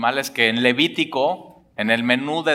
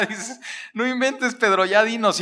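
incredulous listener; 8 semitones higher than usual. Pretend. Mock audience reacting to Peter.

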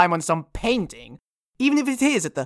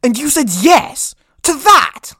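incredulous; 7 semitones higher, 7 dB louder than usual. Acted. Incredulous at accepting case.

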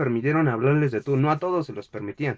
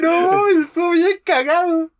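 excited; 12 semitones higher, 5 dB louder than usual. Real, not acted. Laughing over the cookie story.

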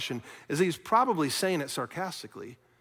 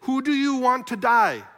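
loud, high demand to a crowd; ringing public question; Pilate's challenge; 7 semitones higher, 6 dB louder than usual. Retold or imitated. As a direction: throw the question out forcefully, pitch raised. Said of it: "Pilate says".

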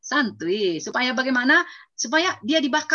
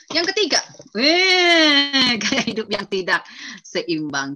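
loud, slow, drawn-out exclamation; emphatic cry; 3 semitones higher, 7 dB louder, much slower than usual. Real, not acted. Preacher announcing the third point to the congregation, loud and slow.

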